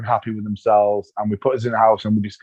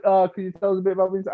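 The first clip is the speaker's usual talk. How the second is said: fast, offhand ask; rushed producer question; 7 semitones higher, 3 dB quieter, much faster than usual. Retold or imitated. Producers asking about sadness, quick and offhand. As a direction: rattle off the request. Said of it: "then they're like".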